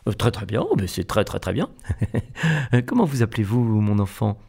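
roguish air. A narrator's reading. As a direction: play it cheeky and a little sly with a small laugh. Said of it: "d'un air coquin".

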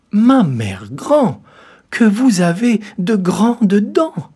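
loud, slow, rising to very high; the girl alarmed at the teeth; frightened girl. A narrator's reading. Girl questions the grandmother.

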